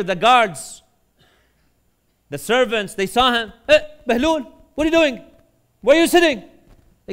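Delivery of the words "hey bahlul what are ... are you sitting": high, loud challenge to an intruder; acted outraged yell; alarmed guards' shout. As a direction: shout it out like guards catching a trespasser. Palace guards catch an intruder.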